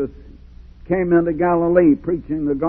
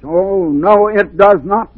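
loud preaching rebuttal; 4 semitones higher, 6 dB louder, slower than usual. Real, not acted. Preacher rebuts the excuse loudly.

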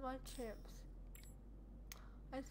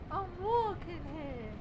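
attempted New York "I'm walking here"; half-hearted New Yorker drawl on "walking"; New York accent; 3 semitones higher, 5 dB louder than usual. Shown as a demonstration. Gamer tries a New York cabbie accent on himself. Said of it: "I can't do the accent".

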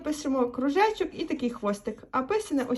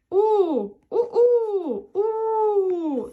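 high, drawn-out imitation wolf howl; 7 semitones higher, 4 dB louder, much slower than usual. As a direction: howl a long "oo" like wolves. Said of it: "Так, вовки виють".

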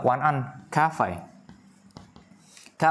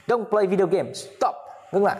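high-pitched mock scolding; demonstrating a telling-off; acted command; 8 semitones higher than usual. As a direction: act out an order to quit, in a raised pitch. Same acted order in English.

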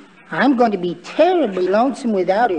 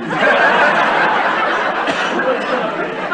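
long giggle; 4 semitones higher than usual. Acted. Wimple chuckles at own joke.

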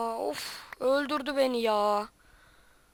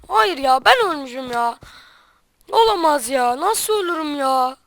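frustrated outburst; 9 dB louder than usual. Real, not acted. Just died in the game.

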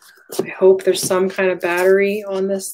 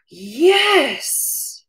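high, thrilled yes; excited yes; 8 semitones higher than usual. Real, not acted. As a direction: cheer it with delight. Cheering the cable.

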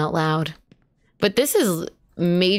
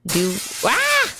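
startled yelp; 6 semitones higher than usual. Real, not acted. Sudden scare mid sentence.

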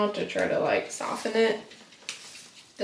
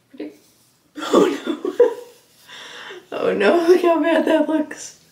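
laughing in dismay; 9 semitones higher, 7 dB louder than usual. Real, not acted. Reacting to hair dye result.

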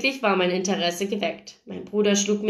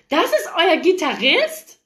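high, louder excited question; thrilled disbelief; 9 semitones higher, 5 dB louder than usual. A narrator's reading. Paige discovers the band's guitarist, high excited voice. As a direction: squeal the question like a fan.

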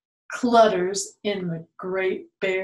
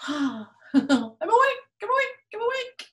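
gasping, high, rising wake-up cry; bear waking up; 12 semitones higher than usual. Acted. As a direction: jolt awake in a panic. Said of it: "Bear wakes up and gnarls and snarls".

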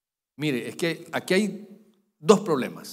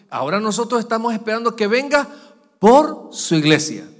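loud, soaring preacher's cry; preaching at full force; 5 semitones higher, 8 dB louder than usual. Acted. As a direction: proclaim it to the whole church. (predicación a todo pulmón)

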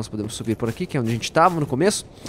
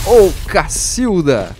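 exasperated exclamation; 4 semitones higher, 6 dB louder, much slower than usual. Real, not acted. Boss fight exclamation.

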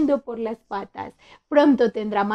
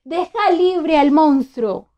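shouting; 4 semitones higher, 8 dB louder, slower than usual. A narrator's reading. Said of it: "gritó".

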